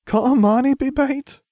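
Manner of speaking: high, loud, drawn-out whine; whining. A narrator's reading. Ron begs out of being bait, whining. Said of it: "Ron whined".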